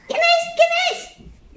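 very high squeaky piglet plea; pleading piglet. Acted. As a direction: beg in a tiny piglet voice. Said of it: "Y los cerditos dijeron".

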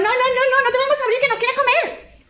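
squeaky stubborn no-no-no; defiant piglet. Acted. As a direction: piglet refusing the wolf. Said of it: "pero dijeron".

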